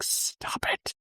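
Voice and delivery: whispered, imitated motherly warning; mock scolding whisper. Mom stopping her daughter breaking her nails, mock scolding whisper. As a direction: hush out a playful telling-off.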